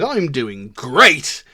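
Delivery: loud, drawn-out mock enthusiasm; fake cheerfulness. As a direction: stretch out a falsely happy reply. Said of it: "putting on this fake face".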